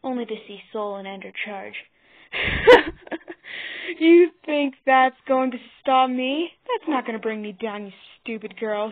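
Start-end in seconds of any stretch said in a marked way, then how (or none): 2.3-3.9 loud, high cackle; villain's cackling laugh. A narrator's reading. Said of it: "he cackled". 4.0-8.9 taunting, loud at first then easing off; cackling. A narrator's reading. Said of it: "he cackled".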